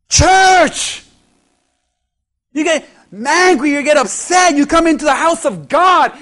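loud, driving sermon with shouted peaks; preaching at full force. Acted. Pastor calls out the congregation, loud shouting preach. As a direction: hammer it home to the congregation.

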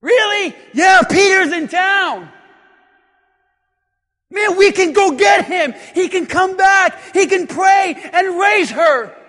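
high, loud, eager shout; acted disciple hatching a hopeful plan; excited rallying. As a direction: shout it out, fired up.

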